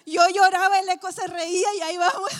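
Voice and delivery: loud, high, laughing while telling it. Real, not acted. Laughing at herself telling the congregation. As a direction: laugh through the words.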